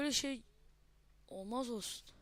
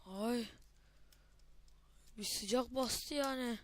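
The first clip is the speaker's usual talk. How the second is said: ordinary talk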